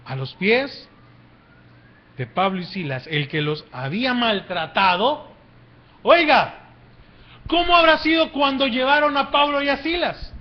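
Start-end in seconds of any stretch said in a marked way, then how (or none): 6.0-10.3 high, loud preacher's rhetorical question; raised, driving sermon delivery; impassioned preaching. Real, not acted. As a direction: preach it at full force, phrase by phrase. Preaches at full force.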